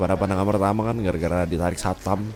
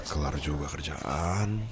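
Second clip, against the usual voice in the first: tired; 5 semitones lower, 12 dB quieter, slower than usual. Acted. Tired boss after work.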